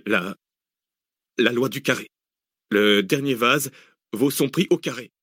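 hesitant captive, groping for the answer; straining to concentrate. A narrator's reading. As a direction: work it out haltingly under pressure. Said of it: "fit un effort de concentration".

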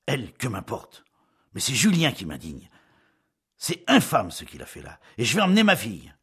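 loud outburst, climbing at the end; agitated indignation. A narrator's reading. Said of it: "qui tremblait d'énervement".

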